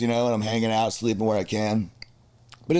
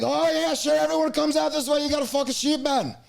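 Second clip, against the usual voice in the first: high hammed-up Irish lad voice; high mock Irish lad urging. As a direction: coax him like a drunk Irish mate, high. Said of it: "They're like".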